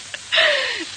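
high, pleased little laugh; gleeful laugh. Acted. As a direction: chuckle at your own cleverness. Laughs over his scheme.

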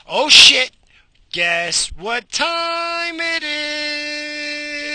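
high, loud, slow announcer call; excited shout. Real, not acted. Announcing coffee break to listeners, shouted high. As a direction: belt it like a game show host.

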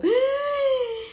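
very high gasp breaking into a laugh; shocked gasp, half laugh; gasp with a laughing cry. Acted. Momento impactante de la serie. (jadeo agudísimo entre risa y llanto)

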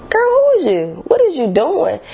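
put-on high, incredulous girl-who-is-you; baffled at herself. Talking to her changed self in disbelief. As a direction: ask your own reflection in disbelief.

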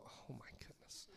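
barely audible whispered thought; whispered dismay. Retold or imitated. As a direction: whisper it to yourself in mock dread. Retold dismayed private thought.

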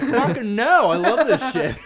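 high, loud defiant yell; defiant shout. Retold or imitated. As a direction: shout back laughing. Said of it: "I'm like".

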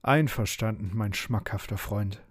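plain reading voice

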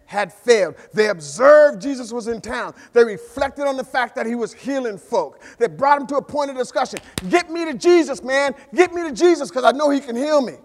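high, loud preacher's build; preaching at full force. Acted. Pastor to church, rising full-force preaching. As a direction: drive it home to the congregation.

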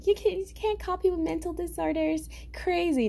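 high-pitched mocking scold; mock objector. Someone coming at me over the C word, mock scolding.

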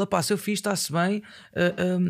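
ordinary talk, nothing marked

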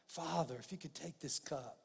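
soft, falling prayer; hushed plea. Retold or imitated. Preacher acting out Jesus praying to the Father in the garden, hushed plea.

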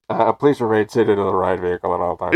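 lightly done recorded-announcement read; a hint of a theme park PA; ride announcement voice. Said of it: "he did this voice".

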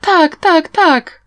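high, loud burst of eager agreement; overjoyed, each word popping up high; delighted. A narrator's reading. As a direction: cry out yes with gleeful eagerness. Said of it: "A Tsing była uradowana".

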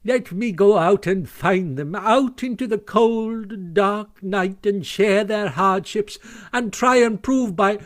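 high, drawn-out melodramatic remorse; sobbing. A narrator's reading. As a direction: plead with theatrical self-pity. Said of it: "sobbed Toad, shedding bitter tears".

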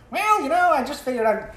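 high squeaky put-on voice; silly high voice. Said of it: "you can't be like".

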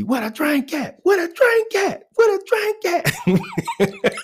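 high mock yell of a rowdy uncle; high, drawn-out demanding uncle voice; loud uncle yelling. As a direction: holler it up high, slow on the last. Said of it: "He comes in yelling".

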